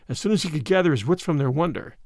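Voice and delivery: ordinary delivery, nothing marked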